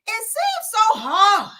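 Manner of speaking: high, slow, trembling-edged recital; hammed-up grief in verse; overwrought poem reading. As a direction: stretch it out as if overcome. Dramatic poetry reading.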